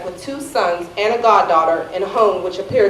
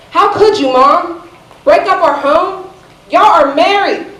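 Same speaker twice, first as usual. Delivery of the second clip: high, loud, pleading reproach; upset protest; 8 semitones higher, 4 dB louder, slower than usual. Retold or imitated. Daughter confronting Mom over the family breakup, upset protest. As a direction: retell a hurt daughter's raised-voice plea. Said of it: "with the lump in my throat swelling".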